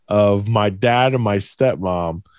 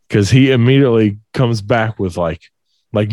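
ordinary talk, nothing marked

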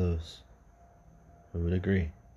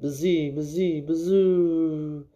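wind noise; 8 semitones higher, 4 dB louder, much slower than usual. A narrator's reading. Wind noise in ghost story.

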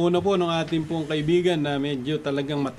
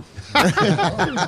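hearty laugh; 4 semitones higher than usual. Real, not acted. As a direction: burst of real laughter. Hosts joke about cook. (malakas na tawa)

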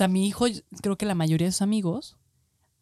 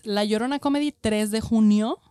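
promo announcement; 3 semitones higher, 4 dB louder than usual. Real, not acted. Guest announcing her show date.

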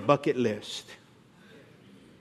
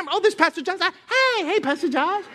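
high, sugary mock hello to the pastor; sing-song fake friendliness, much higher; fake sweet greeting; 10 semitones higher than usual. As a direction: switch instantly to syrupy politeness.

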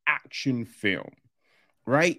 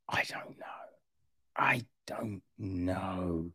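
whispered doubt; 7 semitones lower, 11 dB quieter than usual. Real, not acted. Reviewer admitting doubt to viewers, whispered. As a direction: whisper it twice, trailing off in doubt. Doubting whether film will be good.

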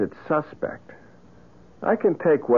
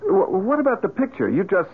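nervous; 8 semitones higher than usual. Acted. Johnny nervous about the picture.

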